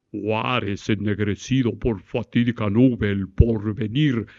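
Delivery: low, slow, throaty fake-sonorous recital; pompous hollow voice. Shown as a demonstration. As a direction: puff up the voice as if it were booming. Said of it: "Ahí estoy engolando la voz".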